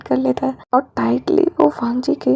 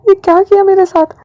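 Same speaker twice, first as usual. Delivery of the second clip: loud, high shocked cry; 6 semitones higher, 8 dB louder than usual. Acted. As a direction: gasp it out in shock. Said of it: "वो shock हो जाता है". (सदमे में ज़ोर से चिल्लाकर)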